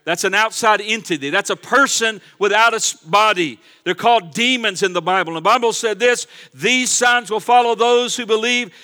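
loud, fervent preaching to the church; full-force preaching. Acted. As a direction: drive it home like a revival preacher.

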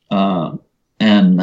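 ordinary talk

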